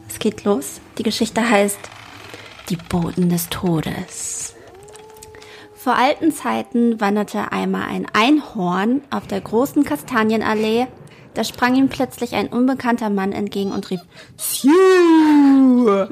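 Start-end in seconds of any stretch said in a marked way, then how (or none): 2.7-4.5 spooky title. Acted. Announcing the story title. 14.4-16.1 loud sudden cry; loud shout. Acted. Stranger's cry in story.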